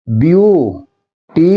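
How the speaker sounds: "Beautiful" slowly as view... टी... फुल; syllable by syllable. Shown as a demonstration. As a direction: break "beautiful" into three drawn-out beats. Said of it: "तीन syllable".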